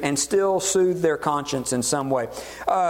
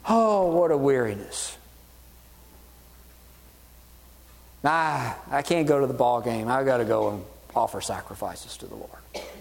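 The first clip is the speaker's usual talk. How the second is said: weary whining; 5 semitones lower than usual. Retold or imitated. Bored worshippers grumbling about sacrifices, mock weary whine. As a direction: whine like it's a chore.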